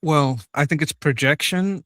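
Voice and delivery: ordinary talk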